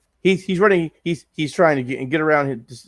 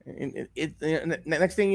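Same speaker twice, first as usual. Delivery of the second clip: usual speaking voice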